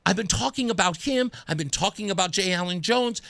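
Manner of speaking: ordinary talk, nothing marked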